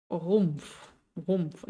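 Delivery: "Rumpf" starting from the throat; German throat R. Shown as a demonstration. Teacher showing learners "Rumpf" with a throat R. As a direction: make the R of "Rumpf" at the back, untrilled. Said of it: "ubicar dónde sale ese sonido".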